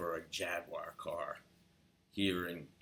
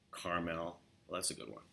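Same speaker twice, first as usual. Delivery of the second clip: ordinary talk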